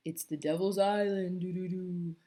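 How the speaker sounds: spooky radio announcer voice, lightly done; a hint of a spooky radio host; deep spooky radio voice. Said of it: "Pete would say in a deep, spooky voice like they do on the radio".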